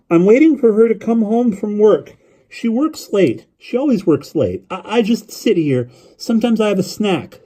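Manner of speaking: put-on high boy's voice, running on eagerly; young boy rambling on the phone; chattering lonely boy. A narrator's reading.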